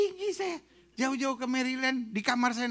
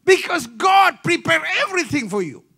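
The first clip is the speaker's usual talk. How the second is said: preaching shout; 5 semitones higher, 11 dB louder than usual. Acted.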